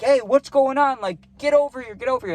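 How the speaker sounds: yelling to the plug while he's being chased. Retold or imitated. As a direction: bark it out to scare them off. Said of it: "Let me be loud".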